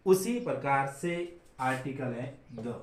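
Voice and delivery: ordinary delivery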